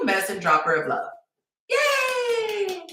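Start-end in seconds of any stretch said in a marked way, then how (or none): 1.7-2.8 sarcastic squeal of joy, pitched far up; mock cheer. To her audience, mocking dating crumbs, sarcastic high yay. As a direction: squeal a fake little yay.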